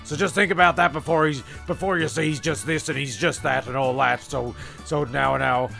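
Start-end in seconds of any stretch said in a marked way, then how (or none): none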